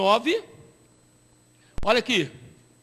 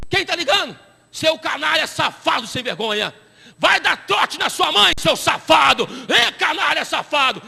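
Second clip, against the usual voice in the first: higher, louder hammed-up tirade at a prankster; mock furious yelling; 4 semitones higher, 4 dB louder than usual. Imagined believer yelling at the caller, mock furious and louder. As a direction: chew out the caller in pretend fury.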